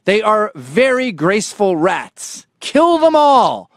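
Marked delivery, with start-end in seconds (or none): 2.6-3.7 high, drawn-out loud cry; mock-furious shout. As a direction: bellow it as a comic battle cry. Rant against deer peaks.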